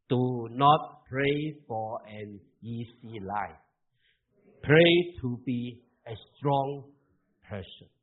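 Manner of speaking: slow, loud, deliberate English reading; reading aloud together. Shown as a demonstration. Pastor leads the congregation reading an English wall motto, slow and loud. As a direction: spell it out word by word for the congregation. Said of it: "一起来读".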